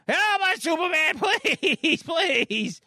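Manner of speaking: high-pitched pleading squeal; Mitch. Acted. Boy calls to Superman for rescue, high and pleading. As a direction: cry out like a desperate child. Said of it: "I gotta keep yelling".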